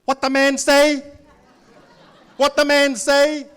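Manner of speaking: high, loud broken English; parents' accent. Retold or imitated. Parent asking the kids what the salesman said, high and loud. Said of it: "this is my parents".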